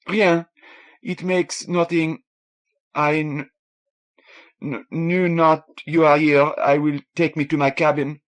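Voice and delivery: a lightly voiced upset young Frenchwoman; tearful young Frenchwoman. A narrator's reading.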